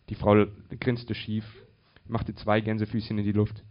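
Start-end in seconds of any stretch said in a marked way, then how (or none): none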